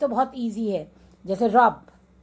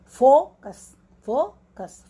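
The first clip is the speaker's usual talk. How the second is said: teacher's split demo of focus, strong first syllable; stress on फो; 3 semitones higher, 5 dB louder, much slower than usual. Shown as a demonstration. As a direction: hit फो hard, let कस fall away. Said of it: "फो पे stress है हमारा".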